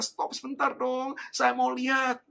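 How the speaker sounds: high, drawn-out plea to stop; pleading child. Retold or imitated. Child begging parent to look at toys, high pleading.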